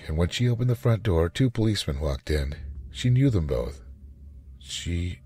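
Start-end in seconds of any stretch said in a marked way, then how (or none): none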